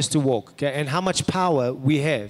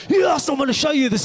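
eager; 8 semitones higher than usual. Retold or imitated. Eager young man boasting.

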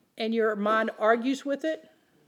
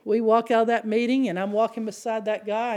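ordinary talk, nothing marked

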